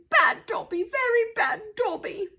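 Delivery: high-pitched elf reproach; self-scolding elf. A narrator's reading. Dazed Dobby back on the bed, scolding himself. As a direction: tell yourself off in a high elf voice. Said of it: "cross-eyed and muttering".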